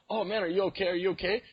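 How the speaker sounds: high, worried check-in; mock alarmed concern. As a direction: ask anxiously if he's hurt. Concerned call to fallen man.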